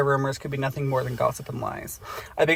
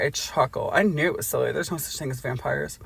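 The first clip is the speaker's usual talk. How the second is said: ordinary talk, nothing marked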